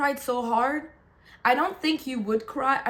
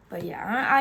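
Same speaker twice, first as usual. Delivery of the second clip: usual speaking voice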